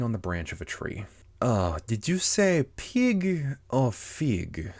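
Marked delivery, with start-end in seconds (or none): 1.4-4.7 high, very drawn-out cat voice; Cheshire Cat. Acted. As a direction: stretch the question out slowly like a teasing cat. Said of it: "said the cat".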